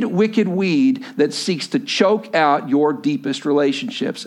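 usual speaking voice

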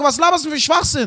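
high-pitched indignant outburst; mock shouting. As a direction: fire back in comic outrage. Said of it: "du schreist doch gerade".

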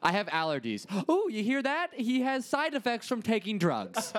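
mock a gossiping rich mom; a hint of a condescending mom; snooty rich mom. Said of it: "she sounds like the mom of a girlfriend who's rich".

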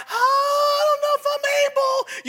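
high, crying whine of self-pity; whiny excuse voice. Retold or imitated. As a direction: whimper it like a scared believer. Mocking timid excuse makers.

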